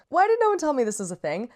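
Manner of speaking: fast, loud gushing disbelief; can't believe nobody said; excited outburst. Real, not acted. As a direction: blurt it out, thrilled you never knew. Gushing about discovering new genre.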